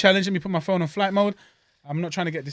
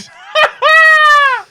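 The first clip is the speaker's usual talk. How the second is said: loud high cackle bursting out; big burst of laughter; 14 dB louder than usual. Real, not acted. Excited eater bursting into loud laughter. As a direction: laugh out loud, unable to hold it in. Excited before eating challenge.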